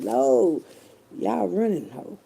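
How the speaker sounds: ordinary talk, nothing marked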